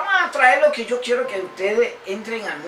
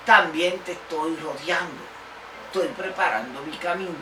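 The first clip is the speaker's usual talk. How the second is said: ordinary talk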